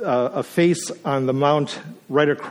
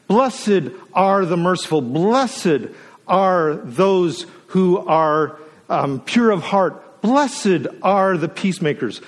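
raised, slow and emphatic, each Blessed lifted high; preaching the Beatitudes; 4 semitones higher than usual. Acted. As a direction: proclaim each blessing like a litany from the pulpit.

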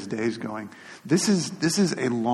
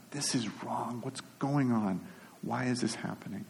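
mild lament, hushed; 9 dB quieter than usual. Retold or imitated. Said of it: "Crying out to God".

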